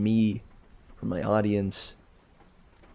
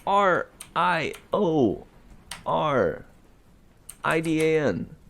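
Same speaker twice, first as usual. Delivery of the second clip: spelling out; 4 semitones higher than usual. Shown as a demonstration. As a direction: spell R I O R D A N out letter by letter. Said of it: "it is spelled".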